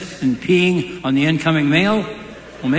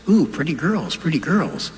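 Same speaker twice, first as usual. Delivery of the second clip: lightly voiced parrot; 2 semitones higher than usual. Parrot greeting the daughters with a light cooing voice. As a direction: coo it lightly like a brothel parrot.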